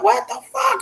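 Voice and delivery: high-pitched tearful disbelief, re-enacted; retold crying. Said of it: "I'm crying".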